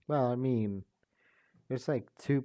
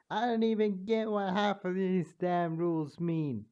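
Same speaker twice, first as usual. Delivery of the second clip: King Dedede voice; 6 semitones higher than usual. Acted. A narrator voicing King Dedede after studying the show, pitched up. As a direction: bluster like the cartoon king. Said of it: "for King Dedede right now".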